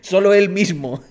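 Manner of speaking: laughing loudly; cracking up. Real, not acted. Laughing at the clip.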